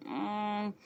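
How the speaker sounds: normal talking voice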